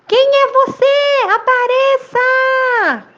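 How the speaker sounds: very high, loud, stretched-out call; echoed shout. A narrator's reading. Mountain echo repeating the boy's demand, high slow shout. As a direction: send the shout back like an echo. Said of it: "ele escutou".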